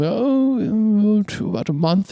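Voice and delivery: put-on high, fussy old man voice. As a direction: chatter it like a fussy old craftsman. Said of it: "this old guy".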